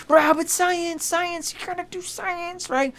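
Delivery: very high, slow put-on voice, fading soft; hammed-up dude pleading for science; mock science bro. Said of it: "mocking science bro".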